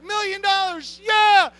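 high, loud, overjoyed whooping; Paul whooping with joy at seeing heaven; ecstatic whoa. Acted. Paul sees the prize.

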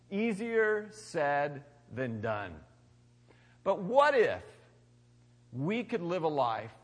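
ordinary talk

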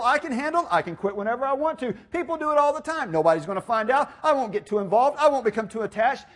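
brisk run of insistent self-justifications; rapid-fire excuses. Acted. Preacher voicing our rationalizing self-deception, rapid-fire excuses. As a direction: rattle off excuses, pitch jumping up.

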